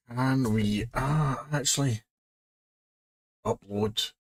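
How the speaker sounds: normal talking voice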